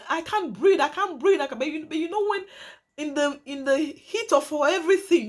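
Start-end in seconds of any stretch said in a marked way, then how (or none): 0.1-1.6 high-pitched retold cry for air; retold shouting. Woman being strangled by her partner, retold shout. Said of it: "And she was shouting".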